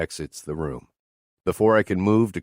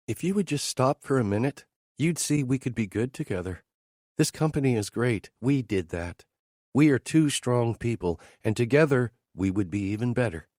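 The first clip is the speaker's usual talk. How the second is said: woman pitching romance to her boss; Teresa's voice; 5 semitones higher than usual. Acted. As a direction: coax him, a little higher. Teresa speaks.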